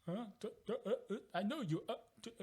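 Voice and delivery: high-pitched confused stammering; mock befuddled sputtering, words barely getting out; Vision. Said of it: "he's like".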